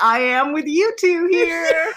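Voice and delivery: a hammed-up granny, half laughing; old lady. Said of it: "And she's like".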